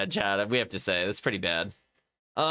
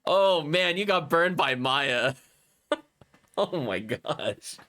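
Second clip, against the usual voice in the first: ordinary talk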